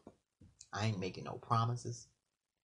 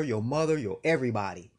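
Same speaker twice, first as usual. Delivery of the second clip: usual speaking voice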